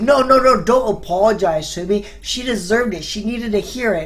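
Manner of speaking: loud, high burst of no's, then insistent; emphatic refusal. Retold or imitated. Kid waving away an apology for the girlfriend, emphatic.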